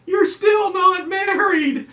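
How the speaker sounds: very high, loud, slow mock cry; wailing shout. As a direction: shout it out through tears. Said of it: "She goes".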